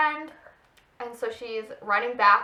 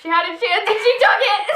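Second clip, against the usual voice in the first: higher and louder, mock dramatic; hammed-up triumph; 9 semitones higher, 9 dB louder than usual. Friends reading their old fanfic, mock dramatic. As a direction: declare it like a soap heroine.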